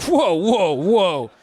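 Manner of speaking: loud, high startled whoas; mock shocked whoa. Comic reacting to a porn title, loud startled whoa. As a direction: throw up your hands in mock alarm.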